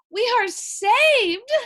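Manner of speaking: high, loud, slow cry of relief; overjoyed cry. A narrator's reading. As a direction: sing out the rescue, overjoyed. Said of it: "cried Missus Watson".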